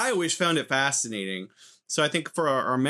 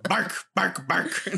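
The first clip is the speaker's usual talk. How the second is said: higher-pitched playful barking; "Bark" yapped out, pitched up; imitated dog bark; 5 semitones higher, slower than usual. Shown as a demonstration. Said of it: "bark and ruff".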